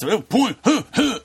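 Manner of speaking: blustery mock grunting; aggressive Chris Christie. Co-host mocking Christie's aggressive crap, blustery grunts. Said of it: "this aggressive crap where he".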